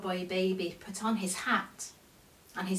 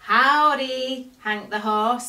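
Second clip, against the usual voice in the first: put-on Cowboy Baby greeting; 3 semitones higher, 8 dB louder than usual. A narrator's reading. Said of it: "said Cowboy Baby".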